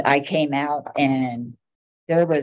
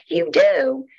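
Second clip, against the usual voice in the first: retold disbelieving question, pitched up high and louder; surprised; 11 semitones higher, 5 dB louder than usual. To the photographer claiming a permit, surprised. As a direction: echo her claim back in mock astonishment.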